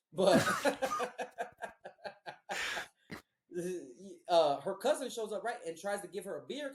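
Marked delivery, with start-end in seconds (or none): 0.5-2.9 big laugh. Real, not acted. Laughs at his own joke.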